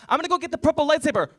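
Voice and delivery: high, loud fast crying whine; whining kid. Retold or imitated. Little brother wailing that he wants the purple lightsaber. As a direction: wail it like a kid on the edge of tears.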